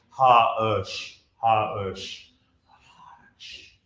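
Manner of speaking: "Ha-ush" split in three parts, fading to a whisper; triplet hush. Shown as a demonstration. Director showing the hush rhythm to the choir. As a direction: stretch the hush over a triplet. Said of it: "it's almost a triplet".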